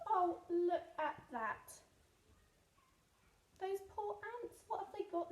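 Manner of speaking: ordinary delivery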